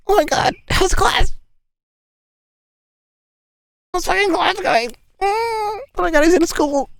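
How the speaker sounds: very high, teary, overjoyed mom voice; mock your mom near happy tears; very high sobbing-happy mom; gushing mom. Mom gushing over him at college.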